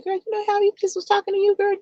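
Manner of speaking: put-on high, fast, hushed girlfriend gossip; quick high gossiping girlfriend voice; gossipy girlfriend. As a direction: lean in and dish like a nosy friend.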